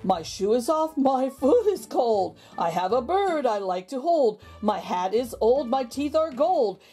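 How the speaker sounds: usual speaking voice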